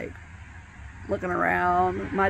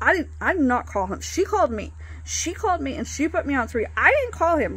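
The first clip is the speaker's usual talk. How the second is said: higher, louder, insistent denial; defensive protest; 8 semitones higher, 4 dB louder than usual. Retold or imitated. Daughter defending herself to her dad, loud protest. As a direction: plead your innocence fast. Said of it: "Like, I'm almost in full defense mode".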